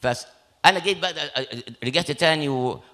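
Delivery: ordinary talk, nothing marked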